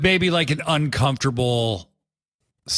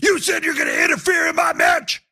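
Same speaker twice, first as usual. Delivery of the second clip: acted Swerve quote; 14 semitones higher, faster than usual. Swerve yelling at Hangman about interfering. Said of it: "he goes".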